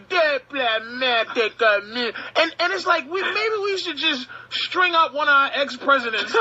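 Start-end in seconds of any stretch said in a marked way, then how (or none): 0.1-2.2 "Diplomatic immunity" in a lightly put-on foreign accent; smug foreigner claiming immunity; hint of a foreign accent; mock foreign accent. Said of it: "running around going".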